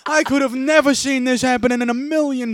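loud, high Italian bystander; Italian accent. Acted. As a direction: overplay the shocked Italian. Continues the Italian bit.

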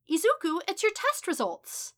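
high, loud, urgent motherly cry; mother's excited call. A narrator's reading. Mother announces the test results, high excited shout. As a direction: shout the big news to your son.

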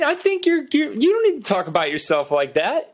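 high, sing-song encouragement; mock cheerleader pep talk.

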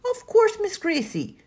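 very high, indulgent lilt; doting housekeeper. A narrator's reading. Missus Cumberstone agrees to Grace's request, doting. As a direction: coo it fondly to a small child.

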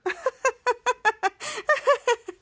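long high-pitched staccato cackle; imitated laughing. Piper laughing at her prank, imitated laughing. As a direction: act out a gleeful prankster laughing on and on. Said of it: "somebody was laughing".

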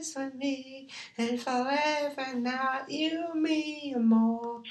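put-on high, soft girlish voice; man doing a woman's voice. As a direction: lift into a soft high girl voice. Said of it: "Sound like a girl".

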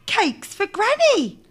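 put-on high, slow, innocent girl; little girl voice. A narrator's reading. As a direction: answer brightly like a small girl.

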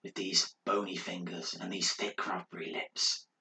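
soft low mumble, fading to a whisper; muttered to himself. Acted. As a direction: murmur it alone in the corner. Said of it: "chatting to himself".

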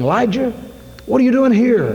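a booming call from heaven; voice of God calling. Retold or imitated. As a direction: call his name from above. God's voice calling Elijah.

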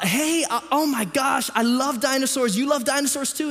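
put-on high-pitched gushing dog trainer greeting a child. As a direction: act out an over-friendly hello, pitched well up. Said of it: "the dog trainer comes and says".

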